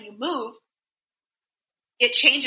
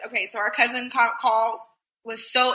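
ordinary talk

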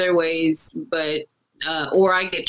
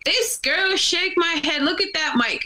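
mocking the troll; 10 semitones higher than usual. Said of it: "and was like".

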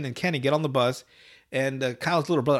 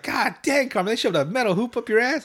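put-on shocked kid voice; 8 semitones higher than usual.

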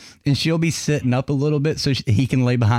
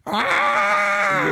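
imitated dog yelp; 8 semitones higher than usual. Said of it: "you guys would hear".

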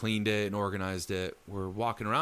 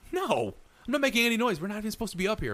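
quoting friend; 11 semitones higher, faster than usual. Retold or imitated. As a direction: replay the friend's startled denial. Friend's denial quoted.

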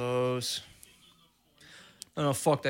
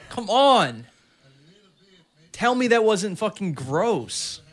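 loud, high, slow outrage; outraged; 6 semitones higher, 5 dB louder, slower than usual. Real, not acted. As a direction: drag out the protest, outraged. Outraged at dirty strike.

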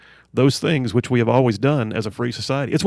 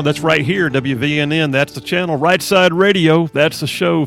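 big, punchy station ident; radio announcer; 3 semitones higher, 5 dB louder than usual. Real, not acted. Host reopening his show after a break, announcer style. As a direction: sell the call letters with lifted, louder energy.

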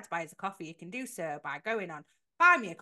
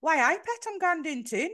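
high-pitched put-on Geordie; hammed-up Geordie, pitched up; Geordie impression; 6 semitones higher, 4 dB louder than usual. Shown as a demonstration. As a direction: put on a playful Geordie accent. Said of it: "do your Geordie impression".

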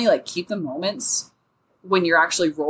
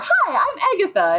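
Agatha's cheery greeting; 10 semitones higher than usual. Retold or imitated. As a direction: chirp it like an eager ditzy monk.

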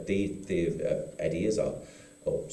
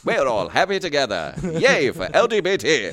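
posh nineteen fifties voice; 5 semitones higher, 8 dB louder than usual. Acted. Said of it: "posh nineteen fifties voice".

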